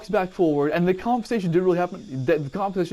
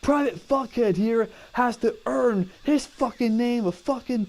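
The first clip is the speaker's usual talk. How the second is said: drill sergeant; 9 semitones higher than usual. Retold or imitated. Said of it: "He goes".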